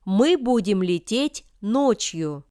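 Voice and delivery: normal talking voice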